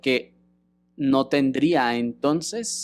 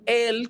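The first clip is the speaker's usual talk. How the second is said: Él pitched high and stressed; Él said high, like an exclamation; emphatic Él; 7 semitones higher than usual. Shown as a demonstration. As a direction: spike the word Él. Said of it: "con acento en la E".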